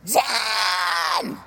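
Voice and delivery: far-off shouted name; mama bear call. Retold or imitated. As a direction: call him like an angry mom from the beach. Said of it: "I got the mama bear voice coming".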